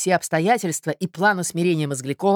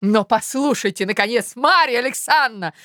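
higher, exasperated protest; baffled outcry; 8 semitones higher, 4 dB louder than usual. A narrator's reading. Puzzled suitor interrupts Marya, baffled outcry. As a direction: cry out in bewildered impatience. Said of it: "вскричал озадаченный Мозгляков".

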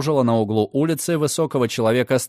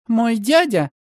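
put-on high, sharp, caustic girl's voice; acid retort; 10 semitones higher, slower than usual. Margaret answers Redfern with biting sarcasm. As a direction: spit it back with sarcasm. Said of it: "едко произнесла Маргарет".

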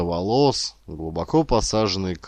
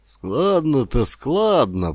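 slow, higher grudging grumble; grumbling; 6 semitones higher, 4 dB louder, slower than usual. A narrator's reading. Grandfather unimpressed by the praise, grumbling. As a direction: draw it out with mild disapproval. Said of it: "проворчал Рыбаков".

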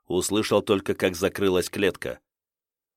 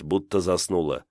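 ordinary delivery, nothing marked

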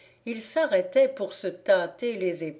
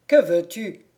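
stern, loud challenge at the door; curt stern question from a blacksmith; severe; 8 dB louder than usual. A narrator's reading. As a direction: demand it severely, louder. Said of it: "demanda sévèrement le forgeron".

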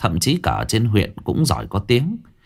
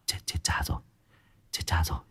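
hushed, frightened whisper; panicked whisper; 5 semitones lower, 12 dB quieter, much faster than usual. Acted. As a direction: whisper in alarm so no one hears. (thì thầm hoảng hốt)

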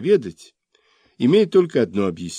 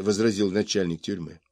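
ordinary delivery, nothing marked

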